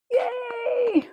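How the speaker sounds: high squealing yay; cheer. Real, not acted. Host celebrates Marilyn's purchase with a high cheer. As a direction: cheer for the buyer.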